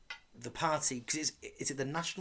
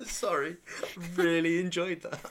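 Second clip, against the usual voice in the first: laughing; 7 semitones higher, 5 dB louder than usual. Real, not acted. Giggling after botched big finish.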